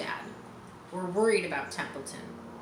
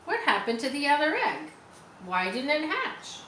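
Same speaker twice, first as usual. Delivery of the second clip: lightly voiced sharp rat, pitched higher; 7 semitones higher than usual. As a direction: needle the goose with it. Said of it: "he began in a sharp voice".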